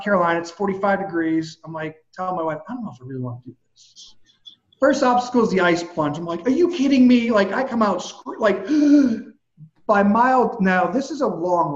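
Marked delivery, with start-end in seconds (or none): 2.7-4.1 quick, much softer and lower, half to himself; low hurried grumble of cold feet; reluctant mumble. Retold or imitated. Reluctant to wife before race. 6.5-7.3 higher and louder retold outcry; incredulous yelp; shocked disbelief. Reacting to the ice plunge. 8.7-9.2 sharp high loud gasp; startled gasp, high and loud; cold shock gasp. Retold or imitated. Coming out of ice water.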